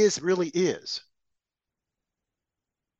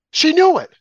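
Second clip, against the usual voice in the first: higher and louder, hammering it home; emphatic outburst; 10 semitones higher, 6 dB louder than usual. Real, not acted. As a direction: spit out the accusation with sudden force. Emphatic accusation in rant.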